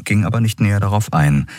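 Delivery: usual speaking voice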